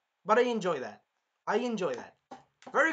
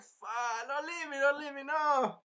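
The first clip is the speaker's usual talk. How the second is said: high-pitched mock begging; hammed-up frantic plea; desperate pleading; 9 semitones higher than usual. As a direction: whine it in an imitated panic. Voicing the hero still begging.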